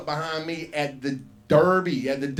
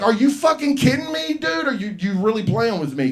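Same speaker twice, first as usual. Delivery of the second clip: higher and louder, incredulous; exasperated yell; 8 semitones higher, 7 dB louder than usual. Retold or imitated. Comic yelling at the magician who denies the boy. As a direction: shout it like you can't believe he's lying. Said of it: "I was like".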